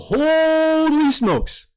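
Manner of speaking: high, slow, stretched-out exclamation; amazed. Real, not acted. Reacting in awe to the Pitbull fight, drawn-out amazement.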